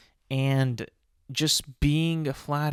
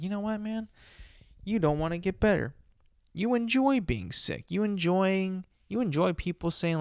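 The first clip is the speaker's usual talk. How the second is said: higher, mocking scold; a higher, sarcastic jab at a sick coworker; 7 semitones higher than usual. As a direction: accuse them of enjoying being sick. Imagined telling off of sick coworker.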